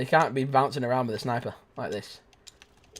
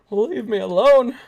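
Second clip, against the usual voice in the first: posh plea; 10 semitones higher, 7 dB louder, slower than usual. Acted.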